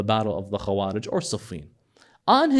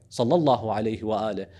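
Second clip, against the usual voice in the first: usual speaking voice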